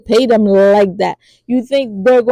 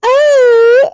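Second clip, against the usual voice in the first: sharp gasp; 7 dB louder than usual. Retold or imitated. Crowd reacts to the king.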